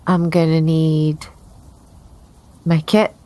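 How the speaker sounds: ordinary delivery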